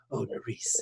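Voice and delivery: soft, breathy, whispered romance; breathy romantic voice. Retold or imitated. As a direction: breathe it like a crooner. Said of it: "He was using that voice here".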